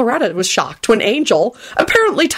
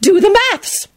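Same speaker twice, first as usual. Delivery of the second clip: high, triumphant jab; pleased-with-herself crow; exultant boom; 13 semitones higher than usual. A narrator's reading. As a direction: gloat in a lifted voice. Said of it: "boomed out exultantly".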